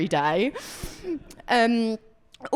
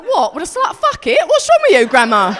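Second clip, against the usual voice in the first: much higher, much louder shocked yelling; outraged shriek; 5 semitones higher, 10 dB louder than usual. Retold or imitated. She yells at her grandma, higher and louder. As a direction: scream it at her in disbelief. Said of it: "And I was like".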